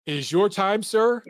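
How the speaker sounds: usual speaking voice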